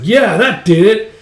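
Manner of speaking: high, loud, drawn-out cheer; acted triumphant cheer. Band sees leader throw opponent down, triumphant cheer. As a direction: whoop for your champion.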